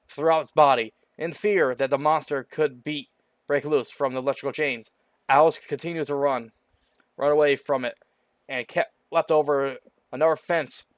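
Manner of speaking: ordinary delivery, nothing marked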